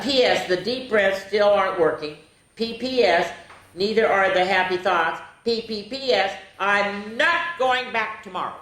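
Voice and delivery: animated picture-book narration; reading to children. A narrator's reading. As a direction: read the diary like a bedtime story. Reads diary entries aloud.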